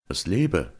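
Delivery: strong-voiced toast. A narrator's reading. Georg toasts the knight's family, in a strong voice. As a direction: round off a toast with some force. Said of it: "mit starker Stimme gerufen".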